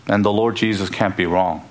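ordinary delivery

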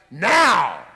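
one loud, sharp order; sharp command. Acted. Jesus ordering the waves to obey instantly. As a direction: bark it with total authority.

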